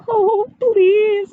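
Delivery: put-on a scared boy begs the bear, tearful and high; whimpering. A narrator's reading. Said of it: "whimpered Eddie".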